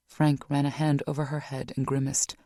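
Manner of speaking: ordinary delivery, nothing marked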